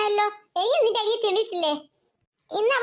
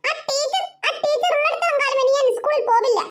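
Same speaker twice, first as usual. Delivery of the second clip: put-on higher child's voice, upset; sulky little girl; 4 semitones higher than usual. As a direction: play a small girl refusing school. Child Devu complains about teacher. (പിണങ്ങിയ കൊച്ചുകുട്ടിയുടെ ശബ്ദം)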